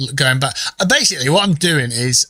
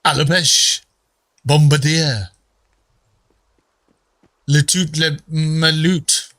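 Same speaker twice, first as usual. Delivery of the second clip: mock French; slower than usual. Shown as a demonstration. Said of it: "I can't give you an example".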